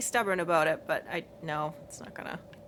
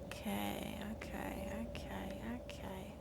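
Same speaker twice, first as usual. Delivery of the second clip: softer and softer to a whisper; fading whisper; 2 semitones lower, 14 dB quieter, slower than usual. Real, not acted. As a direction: trail off into a nervous whisper. Nervous, quieter each time.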